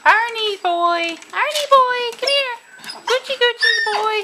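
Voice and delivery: sing-song high call to a puppy; high calling pet voice. Real, not acted. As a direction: call the pup over, high and sweet. Calling puppies to come.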